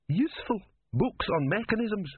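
high, rising incredulous questions; incredulous scorn. Acted. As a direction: query it in high-pitched disbelief. Philosopher scorns inventor's choice.

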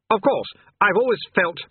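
high, self-important agreement; puffed-up demon king. A narrator's reading. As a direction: swell with pride until cut off. Swelling king, cut off.

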